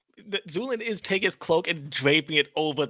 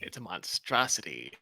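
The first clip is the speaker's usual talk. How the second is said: whispering; 11 semitones lower, 6 dB quieter than usual. Real, not acted. Hushed aside at the table.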